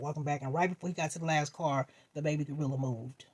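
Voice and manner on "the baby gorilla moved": ordinary delivery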